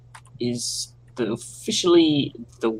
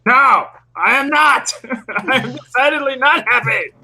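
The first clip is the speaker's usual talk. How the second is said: outraged; 9 semitones higher, 4 dB louder than usual. Real, not acted. Upset player protests loss.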